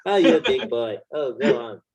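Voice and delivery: mock bedroom cooing through laughter; hammed-up egging on of a lover; fake sexual encouragement. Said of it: "it's like fake moaning during sex".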